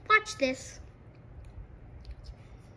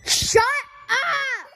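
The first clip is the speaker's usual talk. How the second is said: sudden loud yell; shouting; 12 semitones higher, 6 dB louder than usual. Real, not acted. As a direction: snap it out at full volume. Yelling at dishwasher noise.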